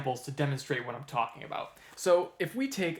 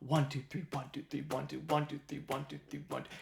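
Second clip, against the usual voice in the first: rhythmic three-three-two count growing softer; tresillo count; 6 dB quieter, faster than usual. Shown as a demonstration. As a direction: count the tresillo under your breath, leaning on "one". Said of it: "matches that flow".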